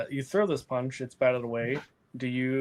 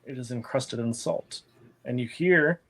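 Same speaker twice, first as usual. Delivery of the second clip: normal talking voice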